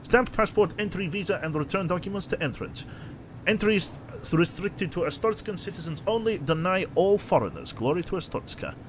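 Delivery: hammy mock-Russian official; bad Russian accent. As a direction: read the notice in a badly faked Russian accent. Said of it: "doing a bad Russian accent".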